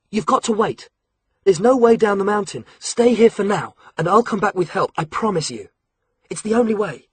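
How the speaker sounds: put-on a teenage spy pleading with the boys to wait; Alex urging the captive boys to stay, lightly voiced; Alex, urgent teen. A narrator's reading.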